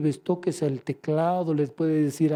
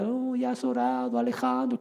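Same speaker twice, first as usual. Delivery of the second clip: higher, sweetly flattering voice; sweet little voice; 6 semitones higher, 3 dB quieter than usual. Retold or imitated. The enemy flatters him out of praying, sweet little voice. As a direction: coo it like a tempter being kind. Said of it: "Qué bonita me hablaba. Dulce".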